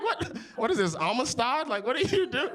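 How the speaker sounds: put-on high-pitched, incredulous kid's retort; baffled protest. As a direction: question your dad in squeaky disbelief. Young son baffled by dad's outburst.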